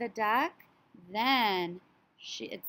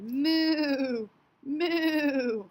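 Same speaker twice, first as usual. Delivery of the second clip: higher, louder mooing; cow moo; 5 semitones higher, 4 dB louder than usual. Acted. Teacher giving the cow's sound to children, loud moo. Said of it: "This animal said".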